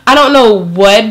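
ordinary talk

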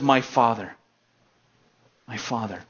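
soft, fading to almost nothing; broken plea. Acted. Prodigal son, cornered, quietly calls for his father. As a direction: let it die away in despair. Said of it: "he finally gets backed into a corner and he says".

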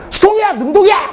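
high-pitched rapid-fire quiz question; urgent quiz demand. Retold or imitated. Teacher springing a grammar question on class, high and urgent.

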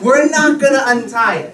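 normal talking voice

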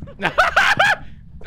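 loud high shrieking laugh. Real, not acted. Laugh shout after escape.

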